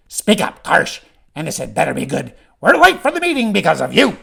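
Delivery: loud, high goblin scolding; angry goblin. Acted. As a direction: berate a youngster in a goblin voice, peaking at the end.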